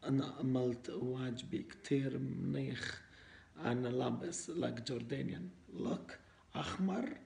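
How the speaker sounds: put-on foreigner speaking broken Arabic with an accent; Arabic with a Western foreign accent. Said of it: "إحنا لازم نحكي عربي مكسر".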